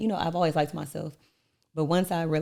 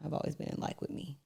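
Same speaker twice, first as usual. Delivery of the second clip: hushed aside; 11 dB quieter than usual. Real, not acted. Quiet confident aside.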